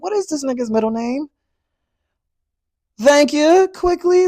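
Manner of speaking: high, loud, worked-up outburst at the audience; excited rant. Real, not acted. Calling out viewers who rush into love, high and loud. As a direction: throw up your hands and call them out, loud and high. Ranting at viewers about rushed relationships.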